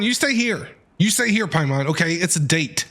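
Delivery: normal talking voice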